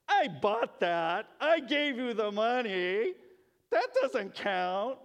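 loud, slow, high indignant complaint; hammed-up outrage over the money; mock grumpy mom. Mom grumbling that she paid for the gift, loud mock indignation. Mom's snarky inner reply.

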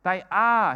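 "are" punched loud; stress on ARE. Shown as a demonstration. Preacher driving home present tense, "are" stressed. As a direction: hit "are" hard. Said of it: "He's talking in the present tense".

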